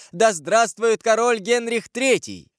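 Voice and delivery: high, slow cheer for a king; crowd's drawn-out cry of hail; echoed acclamation. A narrator's reading. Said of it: "Все повторили этот возглас". (протяжный торжественный возглас)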